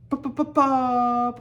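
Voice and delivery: high, loud popping sounds; cartoonish popping noises; dragon popping noise. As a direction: pop it out like a little dragon. Dragon noise in read story.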